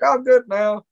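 high, loud chirpy relief; mock instant relief. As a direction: bounce back as if cured at once. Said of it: "It's like".

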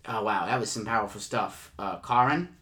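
ordinary talk

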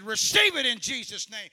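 commanding shout. Retold or imitated. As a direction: thunder it like a healing evangelist. Preacher commanding in the retold prayer.

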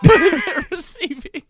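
high, slow laughing question. Real, not acted. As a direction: ask it through laughter. Asking while laughing hard.

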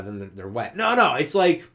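usual speaking voice

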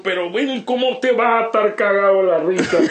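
loud, high protest through laughter; laughing, high-pitched mock scolding; acted incredulous protest. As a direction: retell your exasperation, high and loud.

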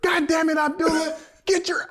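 high, exasperated mock yell; a fed-up coach cursing his player. Coach yelling again.

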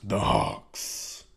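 drawn-out, husky echo of a team name; mock ominous. Host echoing the rival team's name, mock ominous. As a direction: intone it like a villain's name.

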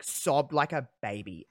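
normal talking voice

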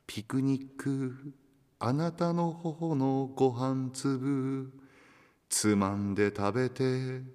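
slow, measured poem reading; poem recitation. Acted. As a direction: let each line hang slowly. Reads a listener's tanka aloud. (ゆっくり朗読する詩)

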